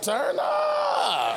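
high party yell of turn up; hype shout. Retold or imitated. Preacher hyping the wine miracle, high party yell. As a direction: yell it like a crowd getting hyped.

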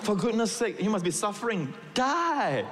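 high, comic urging of the chicken; mock exasperated plea. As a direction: plead with the chicken to die. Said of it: "I feel like saying".

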